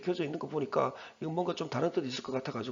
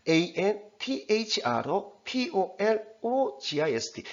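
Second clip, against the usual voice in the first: slow, deliberate 에이엔티에이치알오피오엘오지아이에스티, grouped by syllable; careful syllable spelling; 5 semitones higher, slower than usual. Shown as a demonstration. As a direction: spell 에이엔티에이치알오피오엘오지아이에스티 slowly, pausing at each syllable break. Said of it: "이런 식으로 하라는 겁니다".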